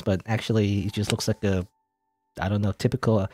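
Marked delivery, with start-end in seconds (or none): none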